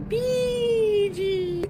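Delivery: high drawn-out cat's name; high pet talk. Real, not acted. Talking to her cat.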